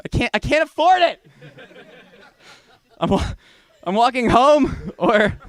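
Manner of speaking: high, loud, worked-up plea; high-pitched broke man's rant; exasperated outburst. Acted. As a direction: protest your hard luck at the top of your voice. Explaining to cab drivers, worked up.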